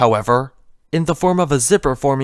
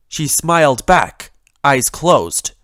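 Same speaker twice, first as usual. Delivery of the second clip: plain reading voice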